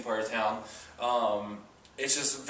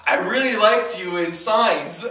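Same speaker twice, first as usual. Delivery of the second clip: slow, loud teasing jab; slow it down and needle him; teasing deadpan; 5 semitones higher, 8 dB louder, slower than usual. Retold or imitated. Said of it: "follows it up with the line".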